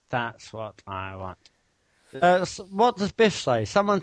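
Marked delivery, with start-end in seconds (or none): none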